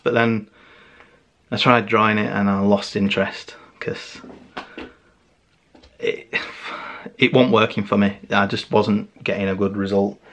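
usual speaking voice

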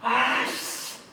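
long drawn-out curse of regret; sinking realization of a blunder; dismayed groan. Acted. Realizes they badly messed up.

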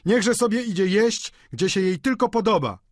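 high-pitched dismissive cry; calling out. A narrator's reading. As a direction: call it out like jeering diners. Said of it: "zawołało kilka głosów".

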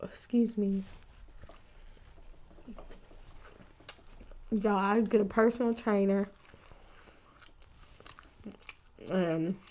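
speaking between bites; chewing while talking. Real, not acted. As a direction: mouth partly full. Seen 3: Bites wing at 0.9–2.7, then talks with lips pursed, chewing.

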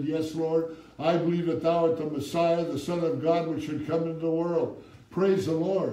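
full-throated sermon climax; preaching at full force. Acted. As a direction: lift the church with every phrase.

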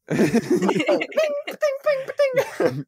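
high ringing ding sounds; sonar ping. Acted.

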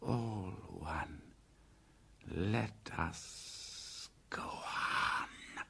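low unvoiced sulky hiss; hissing snake. Python Kaa answers Bagheera, hissed and sulky. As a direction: breathe it out like a sulking python.

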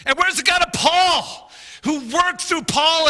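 high, loud pleading cry; crying out to God. Retold or imitated. Preacher retelling his prayer, crying out. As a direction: call out to God in desperation. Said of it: "I'm just crying out to God".

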